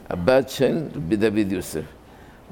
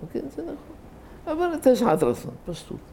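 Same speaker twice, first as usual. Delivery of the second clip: put-on a frail old sage chuckling his answer; frail old rabbi; 5 dB quieter than usual. Elderly rabbi answering the preacher's question, frail chuckle.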